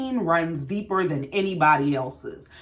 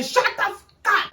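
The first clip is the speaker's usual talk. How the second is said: mock scream; 12 semitones higher than usual. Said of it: "them screaming".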